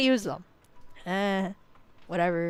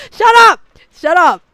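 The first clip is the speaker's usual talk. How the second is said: much higher, much louder shout; real shout; 9 semitones higher, 15 dB louder than usual. Real, not acted. Yelling at chat to drop pineapple pizza, full shout. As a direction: blast it at the chat.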